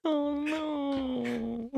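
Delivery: very high and soft, a whimpering put-on voice; a tiny meek whimper; soft submissive voice. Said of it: "You sound soft and submissive".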